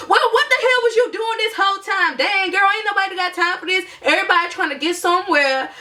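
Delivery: high, mocking impression of a rude driver; hammed-up scolding bus conductor; exaggerated high voice of an annoyed driver. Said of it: "And he was like".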